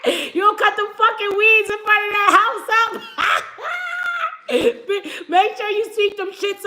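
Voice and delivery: laughing mock yell. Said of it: "Come on, [name]".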